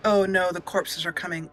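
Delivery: usual speaking voice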